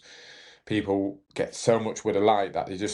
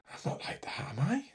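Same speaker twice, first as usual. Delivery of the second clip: a frightened aside under your breath; whispered plea; 10 semitones higher, 8 dB quieter than usual. Retold or imitated. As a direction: whisper it to mum, much softer. Asking mum after the shouting patient.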